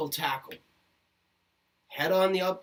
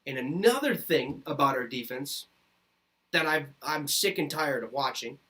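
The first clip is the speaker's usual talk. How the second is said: usual speaking voice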